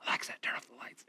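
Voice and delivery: sneaky whispered reply. Retold or imitated. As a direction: hiss it right back at the device. Prankster answering the yell, giggling.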